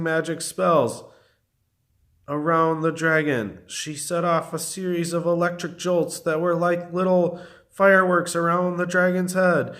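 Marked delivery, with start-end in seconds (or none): none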